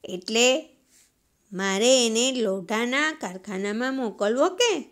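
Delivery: ordinary talk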